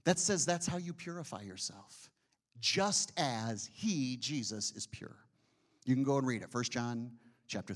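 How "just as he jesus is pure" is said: slow, dropping voice on is pure; emphatic repeat. Acted. Preacher repeats the verse slowly to the church. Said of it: "repeats verse with slow emphasis".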